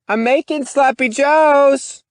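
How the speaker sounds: high, loud calling mother; mother calling through wall. Acted. Mother calling her daughter through the bedroom wall, high and loud.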